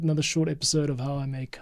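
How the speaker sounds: ordinary talk, nothing marked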